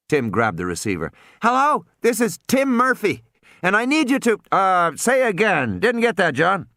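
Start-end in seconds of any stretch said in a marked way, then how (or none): 1.4-6.7 put-on high boy's voice, urgent; a kid pleading into the phone; boy on radio. Tim urging the boat over the radio.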